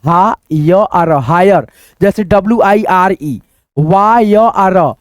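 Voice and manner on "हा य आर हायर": हा य आर हायर split syllable by syllable, slowly; slow teacher's breakdown of higher. Shown as a demonstration. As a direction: sound out हा य आर हायर slowly, then blend it. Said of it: "spelling it out slowly".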